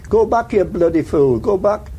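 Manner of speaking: a soldier imitating an enemy's shouted warning; retold shout. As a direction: call out a warning across no man's land. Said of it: "I heard somebody shout".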